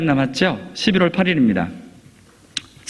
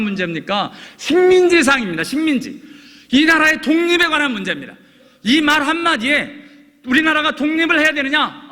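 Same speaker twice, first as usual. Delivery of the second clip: raised, ringing preacher's voice, much higher; fervent preaching; 11 semitones higher than usual. Acted. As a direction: drive the point home from the pulpit. Preaching at full force.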